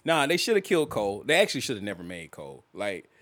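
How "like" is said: ordinary talk, nothing marked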